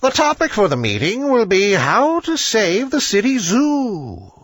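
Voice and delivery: put-on a wise old bird laying out the agenda; authoritative owl. A narrator's reading. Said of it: "He hooted with much authority".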